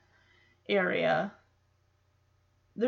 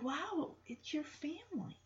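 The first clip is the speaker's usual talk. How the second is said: very soft, higher-pitched wonder; hushed awe; 6 semitones higher, 14 dB quieter than usual. Retold or imitated. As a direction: breathe it in quiet amazement. Voices Jesse's awe at the orcas.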